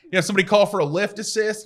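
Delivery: high, loud cheery firefighter arrival; mock firefighter. Firefighter arriving for the lift assist, hammed-up cheery call. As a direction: bounce in as the jolly firefighter.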